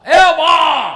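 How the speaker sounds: loud high call; full-chested call; calling out to mother. Shown as a demonstration. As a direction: shout it from the chest. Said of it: "જ્યારે બા બોલતા હતા".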